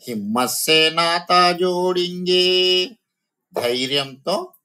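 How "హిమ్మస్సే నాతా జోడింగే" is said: raised, sing-song recital of the line; poem recitation. Shown as a demonstration. Teacher reciting the poem line to her students, sing-song. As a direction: chant the verse to the class.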